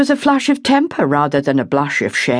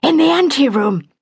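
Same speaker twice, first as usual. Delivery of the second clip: higher, breathless and hurried; urgent; 10 semitones higher than usual. A narrator's reading. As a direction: blurt it out, out of breath from running after her. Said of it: "she said urgently".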